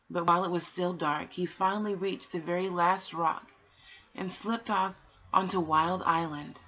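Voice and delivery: put-on gentle read-aloud for children; a calm storyteller reading aloud; storybook reading. A narrator's reading. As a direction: tell it like a bedtime story.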